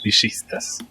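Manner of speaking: "villistas" with the ll as sh; "villistas" said bee-SHEES-tas; Argentine sh sound. Shown as a demonstration. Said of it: "like a sh".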